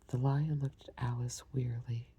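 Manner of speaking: plain reading voice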